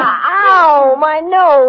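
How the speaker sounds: sharp cry of pain over a hurt nose. Acted. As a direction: yelp as if just hit in the nose. Punched on the nose.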